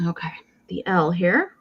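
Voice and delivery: whispered aside to herself. Real, not acted. Whispering while turning the letter L. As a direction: breathe it out, no voice.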